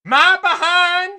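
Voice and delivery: drawn-out, high mock-Southern holler. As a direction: parody her Southern accent, loud and stretched. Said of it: "A O C doing the same thing".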